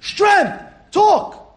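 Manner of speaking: preacher shouting high one-word commands; strong call. Real, not acted. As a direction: hammer each word home. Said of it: "you have to have rogez".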